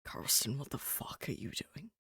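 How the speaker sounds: low, exasperated in-character demand; low-pitched character challenge; deep character voice. Acted. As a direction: drop deep and scold in character. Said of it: "very much like the whole".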